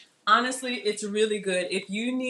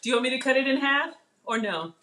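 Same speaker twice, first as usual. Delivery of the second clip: higher, carrying call; yelling across the room; 5 semitones higher, faster than usual. Real, not acted. Calling son from kitchen.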